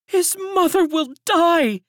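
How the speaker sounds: high, loud lamenting woman's voice; grieving elderly servant. A narrator's reading. Servant in the courtyard laments the stolen child, high and loud. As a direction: cry it out as an old servant in mourning. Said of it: "said the woman who had spoken first".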